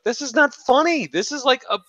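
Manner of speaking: high-pitched retold rant; re-enacting an exasperated telling-off; going off. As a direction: rant indignantly, voice way up. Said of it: "and I'm going off".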